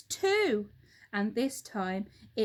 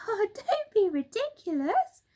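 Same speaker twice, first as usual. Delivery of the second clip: high scoffing monkey; high monkey dismissing; Mugglewump; 11 semitones higher, 5 dB louder than usual. Acted. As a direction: scoff at the silly bird.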